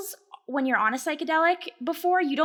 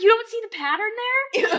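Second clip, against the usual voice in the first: high-pitched mock upset with laughter; imitated upset disbelief; 9 semitones higher, 4 dB louder than usual. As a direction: imitate a friend half crying, half laughing. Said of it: "getting upset, like, crying and laughing".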